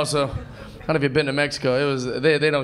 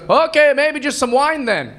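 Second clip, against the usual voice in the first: bartender offer; 8 semitones higher, 3 dB louder, slower than usual. Retold or imitated. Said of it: "He goes".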